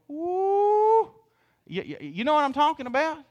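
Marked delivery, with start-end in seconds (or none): 0.1-1.1 high, loud sustained "woo"; heated whoop. Shown as a demonstration. Preacher acting riled at being called out, high whoop.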